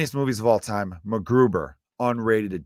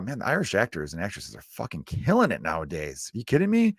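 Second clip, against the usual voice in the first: ordinary talk, nothing marked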